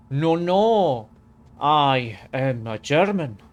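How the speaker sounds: loud, slow mock French accent; French accent posing as German. Streamer plays a Frenchman trying to pass as German, mock French accent. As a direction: put on a French accent, drawn out and emphatic. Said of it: "someone who obviously has a French accent".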